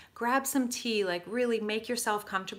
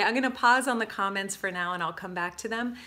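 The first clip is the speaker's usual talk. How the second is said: ordinary talk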